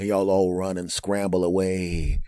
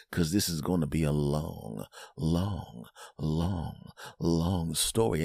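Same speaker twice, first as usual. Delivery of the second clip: slow, low and drawn out; drawn-out weariness; 4 semitones lower, 6 dB quieter, slower than usual. Acted. Friend facing an endless complaint story, slow and drawn out. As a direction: stretch each long out, low and soft.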